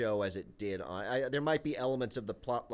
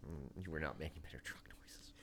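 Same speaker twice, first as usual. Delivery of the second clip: whispering; 9 semitones lower, 16 dB quieter than usual. Real, not acted. Teasing aside whispered to cohost.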